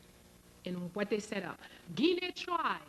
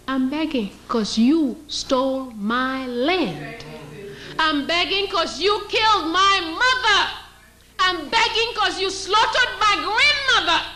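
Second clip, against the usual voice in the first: indignant retort; 5 semitones higher than usual. Pretend. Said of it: "We won't even say".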